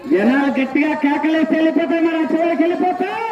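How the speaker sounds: ordinary talk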